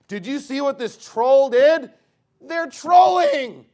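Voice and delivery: high, loud mock outrage, drawn out; mock scandalized. Preacher mimics people sharing troll videos, mock scandalized. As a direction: imitate someone breathlessly reporting a scandal at full volume.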